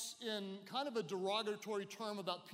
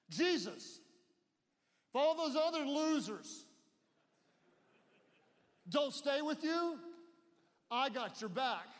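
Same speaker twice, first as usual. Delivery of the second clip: loud, higher boastful loyalty pledge; bragging Peter; 5 semitones higher, 3 dB louder than usual. Acted. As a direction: puff up like a cocky best friend. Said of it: "was a loudmouth. He was bold... liked to brag".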